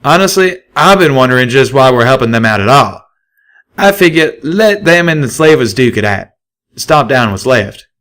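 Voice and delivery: a stallion shrugging off the raiders; loud blunt stallion; Calamity. A narrator's reading. Calamity proposing a plan.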